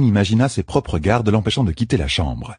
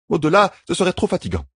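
higher, steady caregiver's voice; firm midwife; 12 semitones higher, faster than usual. A narrator's reading. Midwife explains the treatment to the queen, firmly. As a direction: lay out the rules kindly but firmly.

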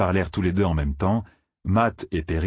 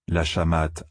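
ordinary delivery

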